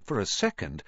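ordinary delivery, nothing marked

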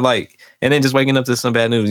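ordinary talk, nothing marked

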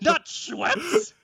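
a spoiled star dog yelling at the crew; diva dog tantrum. Pretend. The star actor dog throws seltzer at the crew, high shrieking mock fury. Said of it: "throwing a can of seltzer, and he's like".

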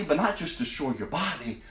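soft, low warning; preacher dropping low about hell; ominous hush. Real, not acted. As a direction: let it sink to a dread hush.

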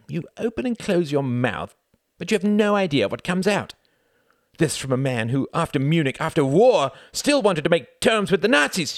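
high, loud retort building in pitch; heated quarrel. A narrator's reading. Reginald and Wendy trading insults about the Nazis, heated. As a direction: escalate phrase by phrase into outrage.

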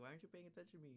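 high-pitched imitation of a needy complaint; mock petulant demand. Demon girl demands attention from her opponent, mock petulance.

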